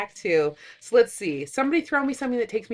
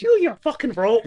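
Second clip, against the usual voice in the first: louder, scornful put-on voice; mock exasperated quote; 4 semitones higher, 9 dB louder than usual. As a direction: sneer it at him. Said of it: "repeating quote".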